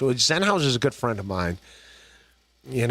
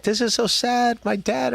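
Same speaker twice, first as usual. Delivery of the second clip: mom crying; 9 semitones higher than usual. Retold or imitated. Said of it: "my mom would always be crying".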